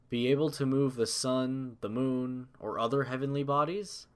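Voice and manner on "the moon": ordinary delivery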